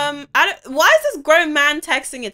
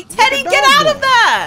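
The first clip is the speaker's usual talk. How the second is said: urgent shout; 11 semitones higher, 8 dB louder than usual. Real, not acted. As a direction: yell him out of danger. Calling child away from fire.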